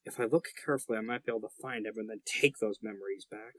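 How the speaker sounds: low, quick muttering aside; under his breath. Acted. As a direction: mutter it to yourself. GM voices NPC under his breath.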